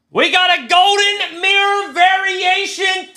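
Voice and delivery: very high, loud screaming of the hit; hype shout. Real, not acted. Streamer screaming to viewers over a rare pull, very high and loud. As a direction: yell it out like a jackpot win. Golden mirror variation pulled.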